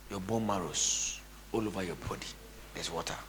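ordinary talk, nothing marked

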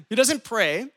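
usual speaking voice

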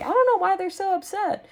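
high, airy innocent shrug; sing-song unbothered disbelief; mock clueless. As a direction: play the oblivious rebel kid. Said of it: "And I was like".

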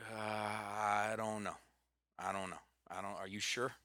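reluctant, trailing quote; reluctant hesitation. Retold or imitated. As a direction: imitate someone dragging their feet, unsure. Retelling Eric's reluctant, doubtful first reaction.

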